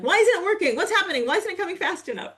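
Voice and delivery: put-on high, loud whining like a toddler stomping its feet; whiny toddler tantrum. Mocking impatient people as a two-year-old throwing a tantrum. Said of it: "stomping your feet like a temper tantrumy two-year-old".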